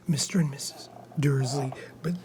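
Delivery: quietly reading a story aloud; hushed reading. Acted. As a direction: read on softly so as not to disturb. Rereads quietly after interruption.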